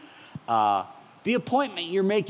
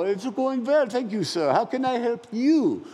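higher, slower, politely surprised service voice; polite agent voice; 8 semitones higher than usual. Retold or imitated. A phone agent answers the cheerful caller with polite high surprise. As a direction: play the startled but polite phone agent. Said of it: "they pause and they go".

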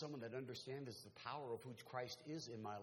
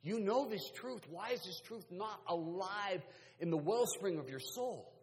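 raised, urgent preacher's voice; forceful preaching; preaching exhortation; 8 semitones higher, 7 dB louder, slower than usual. Acted. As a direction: challenge them from the pulpit, high and loud.

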